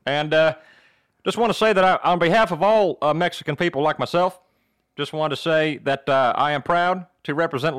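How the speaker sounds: hesitant, folksy Southern speechmaking; Southern accent. Acted. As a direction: mangle the Spanish in a Southern accent. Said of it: "in your Southern accent".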